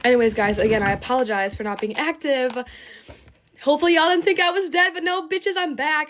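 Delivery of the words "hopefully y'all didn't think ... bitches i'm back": high, quick singsong hype; playful comeback announcement. Real, not acted. Vlogger telling viewers she is back, playful high singsong. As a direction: announce your return fast and high.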